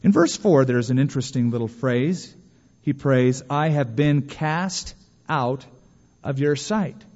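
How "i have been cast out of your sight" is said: slow, drawn-out quoting of a prayer; slow prayer quote. Retold or imitated. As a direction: pray it word by word, with pauses. Quoting Jonah's prayer in verse.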